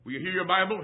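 ordinary delivery

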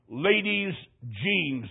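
slow, stretched-out ladies' jeans; drawn out. Real, not acted. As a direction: stretch it for the laugh. Drawn out for comic effect.

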